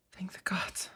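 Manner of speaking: a breathless, soft sigh of relief; relieved whisper. Acted. Vampire whispers relief to the rescued listener. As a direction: let the relief out quietly.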